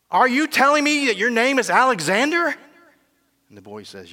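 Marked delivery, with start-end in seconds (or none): 0.1-2.5 much higher, louder disbelief, stress on telling; king loudly challenging the soldier's name; outraged king. Acted. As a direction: hammer the question at him.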